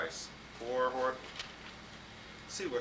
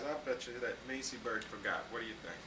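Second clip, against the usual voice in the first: ordinary delivery, nothing marked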